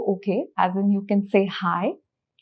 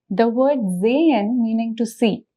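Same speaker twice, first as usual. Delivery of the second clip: usual speaking voice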